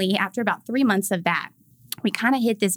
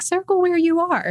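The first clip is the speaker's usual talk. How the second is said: higher, sing-song clinic prompt; chirpy form; 10 semitones higher, 3 dB louder than usual. Retold or imitated. Postpartum checkup sheet asking new moms, chirpy sing-song voice. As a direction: read it like a cheery questionnaire.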